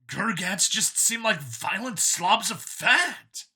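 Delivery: android copy, eager. A narrator's reading. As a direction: sell it like a proud believer. Copy insults species.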